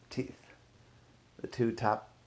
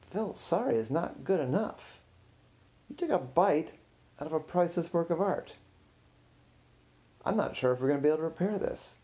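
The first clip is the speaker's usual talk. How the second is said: higher-pitched, exasperated reprimand; indignant scolding; 7 semitones higher than usual. Acted. Curator rejecting the tech guy's apology, indignant. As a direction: scold him in outraged disbelief.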